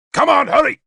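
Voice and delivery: high, loud impatient snap; snapped order. A narrator's reading. King hurrying the nearest guard, snapped command. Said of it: "he snapped at the nearest guard".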